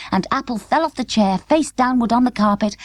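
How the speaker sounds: ordinary delivery, nothing marked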